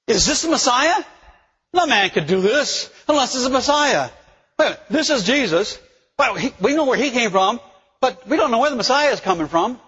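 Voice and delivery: high-pitched voice of a baffled crowd; puzzled crowd. Acted. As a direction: act out bystanders arguing in disbelief.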